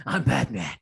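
gruff growled Batman impression; Batman monster voice. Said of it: "he talked in his monster voice".

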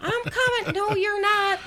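very high, teasing imitation; hammed-up high mock exchange, laughing; mocking back-and-forth. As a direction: act out the useless promise and its retort. Mock exchange with slow partner.

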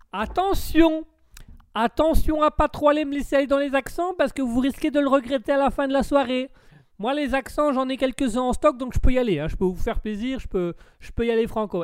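high, loud, hyped-up warning; mock warning. Radio host warns listeners he may get carried away, mock warning. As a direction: raise the pitch and volume, playfully cautioning. Jokingly warns audience about his accents.

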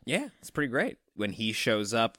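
ordinary talk

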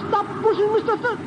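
very high panicked yelp, cut off. Acted. Reluctant parachutist being pushed from the plane, panicked. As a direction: protest shrilly as you are shoved out.

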